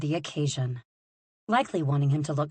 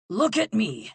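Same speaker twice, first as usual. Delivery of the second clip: higher, louder call; father calling to his son, louder; 6 semitones higher, 4 dB louder than usual. Acted. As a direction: call to get his son's attention. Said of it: "was calling to Tristan".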